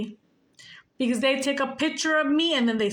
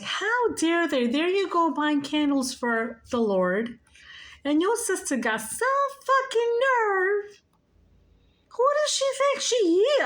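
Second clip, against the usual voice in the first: higher, louder mock outrage from dad; imitating angry dad; 10 semitones higher, 3 dB louder than usual. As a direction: build the indignation as dad rants. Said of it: "he was mad".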